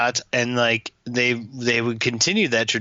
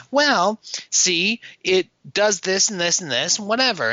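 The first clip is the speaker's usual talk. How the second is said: mock explainer; 8 semitones higher than usual. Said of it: "So, okay, like".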